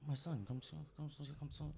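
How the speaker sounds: soft, hushed retold beckoning; old lady beckoning. Old woman calls the man over on the street, hushed imitation. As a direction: imitate a frail stranger coaxing quietly.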